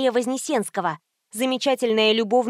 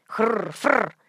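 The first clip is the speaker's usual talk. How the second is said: donkey snort. A narrator's reading. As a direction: double snuffling snort.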